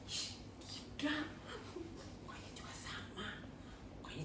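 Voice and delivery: shh, then whisper it like a secret; hushed whisper. Acted. Teacher whispering a secret to the children.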